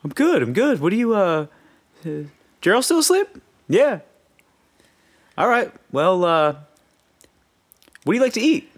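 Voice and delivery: high, eager voice for both sides; mimicked chat. Teen recalls friend's dad quizzing him, high mock voices. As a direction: act out the awkward breakfast exchange, pitched high.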